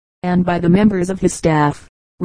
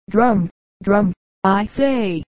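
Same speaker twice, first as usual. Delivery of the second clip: understated roar, pitch swooping on each cry; mock roared command; much slower than usual. A narrator's reading. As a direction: order the drums without really shouting. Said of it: "roared Wadsworth".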